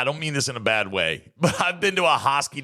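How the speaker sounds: ordinary delivery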